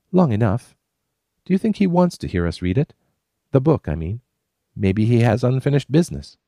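put-on high, musing young woman; a wondering woman by the campfire; Kate's voice. A narrator's reading. Narrator voicing Kate, high and wondering.

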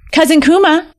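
high sing-out to a cousin; storybook fox hailing someone; calling out. A narrator's reading. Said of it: "Shako called".

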